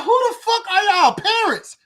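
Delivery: high, loud, slow disbelieving cry; incredulous outburst. Retold or imitated. Father amazed at his polite toddler, incredulous high outburst. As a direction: blurt it out like you can't believe a toddler talks like that. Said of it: "I'm like".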